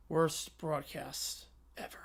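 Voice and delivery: soft, slow whisper; whispered aside. Real, not acted. Narrator whispering a verdict on the broadcast. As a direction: breathe it out in disgust.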